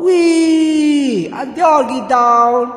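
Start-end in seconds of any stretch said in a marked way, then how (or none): 0.0-1.3 long, held squeal of wee; whooping. Acted. Everyone gets down from the flowers, whooping. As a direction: whoop it out as one sustained note.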